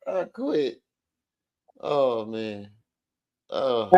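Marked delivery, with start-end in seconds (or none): none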